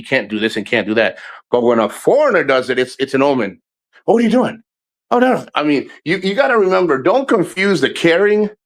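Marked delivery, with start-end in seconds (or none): 4.0-5.5 much higher, fussing mock alarm; mocking overprotective worry; mock worried local. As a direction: ham up a fretting girlfriend. Mocking locals fretting over him.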